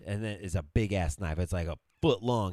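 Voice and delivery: usual speaking voice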